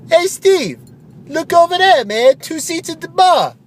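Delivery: high, loud goofy bro voice; Phil the ad pitchman. Acted. Radio ad buddy Phil calling to Steve, high loud bro voice. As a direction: belt it like a cheesy radio ad buddy.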